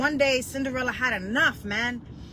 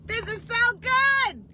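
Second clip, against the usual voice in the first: much higher and louder, slow; excited exclamation; 12 semitones higher, 4 dB louder, slower than usual. Real, not acted. Preacher thrilled by her own message, excited cry. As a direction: burst out in delight.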